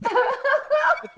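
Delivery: high squeal of laughter through the words; laughing. Real, not acted. Friend laughing at the sandwich artist joke, high laughing reply.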